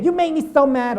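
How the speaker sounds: lightly voiced complainer; quoting people. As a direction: whine it like the person blaming you. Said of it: "people would say that".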